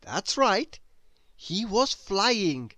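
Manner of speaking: plain reading voice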